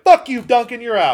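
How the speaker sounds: loud, high mock outburst; mock angry king. King blowing up at his loyal man, mock angry shout. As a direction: bark it like a furious king. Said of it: "he immediately is like".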